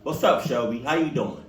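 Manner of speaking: plain reading voice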